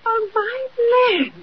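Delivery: high, slow gasp of amazement; old aunt staring at the opening wall; astonished cry. Acted. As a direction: let the wonder slip out, drawn long. Aunt sees hidden chest appear.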